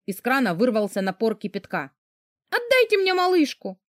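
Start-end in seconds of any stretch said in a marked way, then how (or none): 2.5-3.8 high, alarmed plea; desperate demand. Acted. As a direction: demand the baby back in panic. Frightened demand for the baby.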